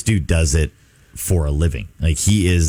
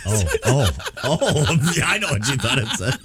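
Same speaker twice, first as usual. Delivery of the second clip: fast, laughing through the words; 6 semitones higher, faster than usual. Real, not acted. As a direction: laugh while you talk. Laughing at the misread.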